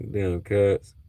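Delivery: ordinary talk, nothing marked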